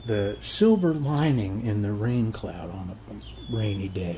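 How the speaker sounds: ordinary delivery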